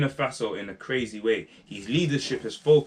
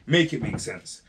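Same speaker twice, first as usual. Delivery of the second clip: ordinary delivery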